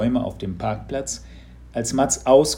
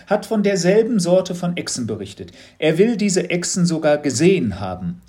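a teacher fishing for a reaction; lightly raised curious teacher voice; knowing teacher, probing; 6 semitones higher, 6 dB louder than usual. A narrator's reading. Teacher tells of Captain William.

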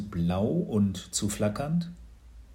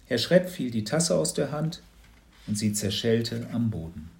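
ordinary delivery, nothing marked

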